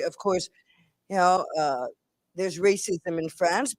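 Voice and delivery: normal talking voice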